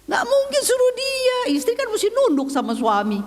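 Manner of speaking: very high-pitched incredulous protest; protes tak percaya. Retold or imitated. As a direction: object in a high, squeaky exclamation. Memperagakan protes dalam hatinya. (protes dengan nada sangat tinggi)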